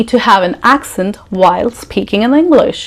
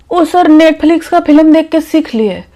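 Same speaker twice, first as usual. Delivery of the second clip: higher-pitched candidate answering in Hindi; imitated interviewee, higher Hindi reply; mock job candidate; 7 semitones higher, much faster than usual. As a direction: drop the accent and answer the interviewer, high-voiced. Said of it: "You go for an interview".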